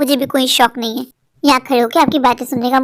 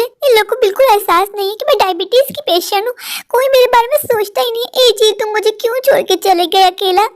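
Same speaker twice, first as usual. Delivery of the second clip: higher, wailing self-pity; mock lament; 8 semitones higher than usual. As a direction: whimper to her dead husband. Lamenting to late husband.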